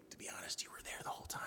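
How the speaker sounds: hushed, unvoiced aside; confiding whisper to the congregation. Real, not acted. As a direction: let them in on a secret, whispering.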